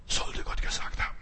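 voiceless, very soft serpent whisper; whispering snake. Retold or imitated. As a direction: whisper it as the sly tempting snake. Snake tempting Eva. (geflüsterte Schlangenstimme)

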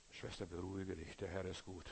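soft, low, calming words; soothing. Retold or imitated. Brother calming an ecstatic church sister, soft and low. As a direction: gently settle an overexcited sister. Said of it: "hat gesagt".

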